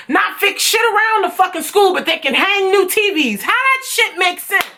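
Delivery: high-pitched, exasperated scolding; indignant rant. Real, not acted. Student ranting about owners hanging TVs, high and indignant. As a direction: lay into them in high indignation.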